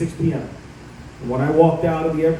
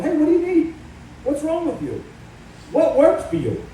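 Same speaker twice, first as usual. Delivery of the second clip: mock caring politician; 9 semitones higher than usual. Imagined politicians asking people.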